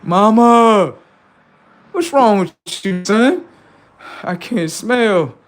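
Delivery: high, loud voice for Mother Nature; high-pitched nature voice. Retold or imitated. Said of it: "I'm like".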